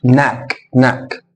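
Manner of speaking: ناك opening directly on n; silent K. Shown as a demonstration. Teacher repeating the correct word, silent K. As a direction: drop the first k in ناك. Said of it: "فما انطقش الكي".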